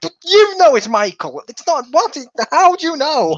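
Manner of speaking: high mocking exclamation; mock disbelief. Mocking the film's characters.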